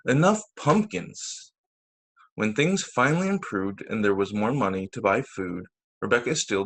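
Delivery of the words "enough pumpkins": usual speaking voice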